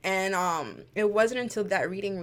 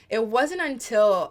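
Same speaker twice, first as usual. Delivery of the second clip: getting emotional; 3 semitones higher, 7 dB louder, slower than usual. Real, not acted. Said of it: "sorry if I get emotional".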